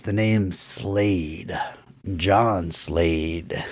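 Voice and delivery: mock-menacing cowboy intro, low and dragged out; a hint of a gunslinger naming himself slowly; tough-guy drawl. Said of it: "John Slade, being John Slade, says".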